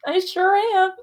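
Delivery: high-pitched, put-on coy voice; mock-bashful, high and drawn out; playful preening. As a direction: act flattered in a high little voice. Acts out reaction to being called queen.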